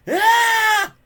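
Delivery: high, loud group yell; the whole tribe yells in terror. A narrator's reading. The tribe yells in terror at the corpse, high and loud. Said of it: "yelled the rest of the tribe".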